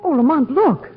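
shocked, loud cry of alarm; frightened cry. Acted. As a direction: cry out in terror. Margot spots the monstrous cat.

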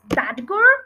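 startled fox recognizing a friend; surprised cry. A narrator's reading. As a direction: call out the name in glad surprise. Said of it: "cried Mister Fox".

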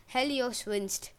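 usual speaking voice

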